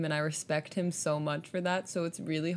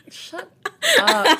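laughing shout; 14 semitones higher, 12 dB louder than usual. Real, not acted. Embarrassed outburst at the line.

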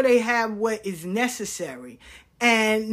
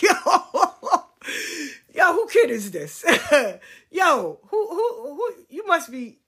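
loud "yo" broken up by laughter; laughing mock disbelief, rising higher; imitated laughing outcry; 6 semitones higher, 4 dB louder than usual. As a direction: crack up while mimicking the stunned kids. Mimicking kids' reaction, laughing hard.

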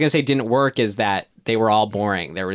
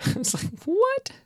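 high squeak of disbelief on what; baffled what; 4 dB quieter, slower than usual. Real, not acted. Co-host baffled by the lazy plot, squeaking in disbelief.

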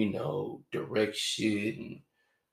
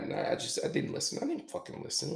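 normal talking voice

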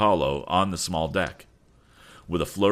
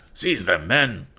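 raised-voice order, rising on the last word; cried command; 4 semitones higher, 4 dB louder, slower than usual. Acted. Officer ordering crew to grab captives, cried command. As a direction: cry out an order to your men. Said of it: "cried the gold-laced officer again".

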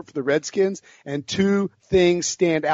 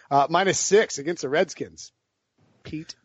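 ordinary talk, nothing marked

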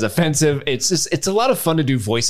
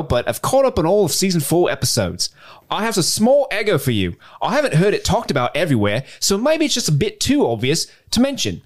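put-on higher, lilting Australian accent; 4 semitones higher than usual. Podcast host reading a listener's email from Perth, Australian accent. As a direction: read it out in your best Australian accent. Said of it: "do this in my best Australian accent".

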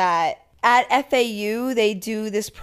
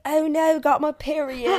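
higher-pitched mock British-Australian accent; 5 semitones higher than usual. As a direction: imitate her uncertain British-or-Australian accent. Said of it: "was she British or was she Australian?".

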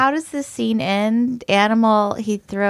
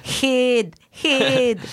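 higher-pitched Scottish bark of one word; Scottish movie impression; 4 semitones higher than usual. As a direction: imitate a Scottish film dad, a bit high. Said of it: "[name]'s now referencing, uh, So I Married an Ex-Murderer".

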